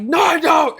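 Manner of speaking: high, loud shouted denial; losing it. Retold or imitated. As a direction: re-enact a kid's outraged yell. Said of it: "lose my shit".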